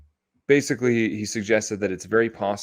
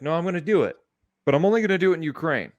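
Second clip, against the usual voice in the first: higher-pitched mock insistence; mock stubborn leader; 6 semitones higher, faster than usual. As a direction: insist like a headstrong leader, playfully. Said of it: "And he's gonna be like".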